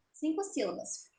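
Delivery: ordinary delivery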